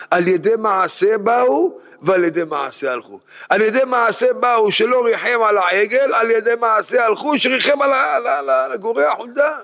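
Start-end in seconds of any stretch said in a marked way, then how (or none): 3.5-9.6 high, impassioned preacher's cadence; fervent preaching. Acted. Rabbi driving home mercy to his listeners, fervent preaching. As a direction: preach it up to the congregation.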